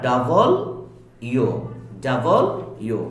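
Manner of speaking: ডাবল, a pause, then ইউ; correct W. Shown as a demonstration. Teacher drilling the letter W, two clear words. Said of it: "ডাব্লিউ বলতে কোনো উচ্চারণ হবে না".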